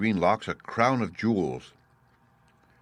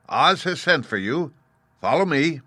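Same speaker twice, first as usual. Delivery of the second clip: raised, brisk soldier's call; a guard fetching someone by order; summoning soldier; 8 semitones higher, 5 dB louder than usual. Acted. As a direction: soldier summoning the scarecrow, lightly voiced. Soldier summons the Tin Woodman.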